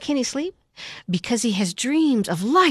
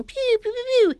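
laser sounds; 12 semitones higher, faster than usual. Retold or imitated. Imitating laser shots.

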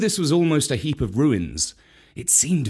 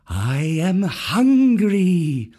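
slow hungry python; 5 semitones higher, much slower than usual. Acted. Kaa speaks.

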